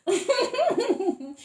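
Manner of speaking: squealing laugh up high; helpless high laugh at herself; high burst of laughter. Real, not acted. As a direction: break into a high giggle. Laughs at own rambling.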